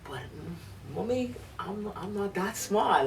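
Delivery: put-on child talking back to her mother, timid; six-year-old self. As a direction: play your small self, unsure and shy. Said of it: "this is just me being a regular six-year-old".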